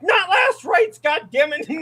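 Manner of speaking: high-pitched frantic shout; indignant yelling. Retold or imitated. Patient refusing the priest's prayer, frantic re-enacted yell. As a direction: yell in panicked protest, reliving it. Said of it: "I'm literally yelling at him".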